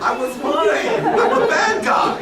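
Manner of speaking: slow, high, laughing through the confession; guilty laughing. Real, not acted. Guest admitting his lie to the manager, laughing. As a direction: laugh it out sheepishly.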